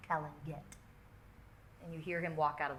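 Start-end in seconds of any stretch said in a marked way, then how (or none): 0.1-0.6 whisper. Acted. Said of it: "villain leaving the room".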